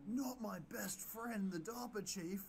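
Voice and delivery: high, slow anguished wail. Acted. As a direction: stretch out grief. Baker devastated.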